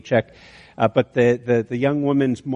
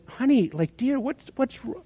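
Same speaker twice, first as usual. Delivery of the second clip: much softer and higher, near breathless hush; tender concern; 10 semitones higher, 5 dB quieter than usual. Retold or imitated. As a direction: lean in gently to a crying stranger. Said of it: "the older woman says".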